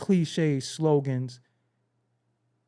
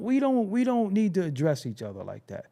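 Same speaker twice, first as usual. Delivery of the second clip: ordinary talk, nothing marked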